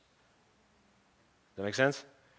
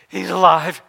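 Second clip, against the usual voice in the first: high, loud excited cry; excited announcement; 9 semitones higher, 18 dB louder, slower than usual. Acted. Mary runs to tell the disciples, excited shout. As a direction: burst out with the news at full voice.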